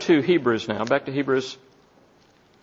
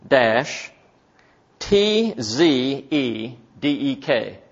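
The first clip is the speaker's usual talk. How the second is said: ordinary delivery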